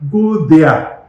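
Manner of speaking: loud, high, emphatic send-off; forceful retold order to leave; acted dismissal. Retelling staff turning patients away.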